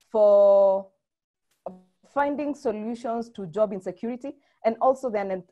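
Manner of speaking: normal talking voice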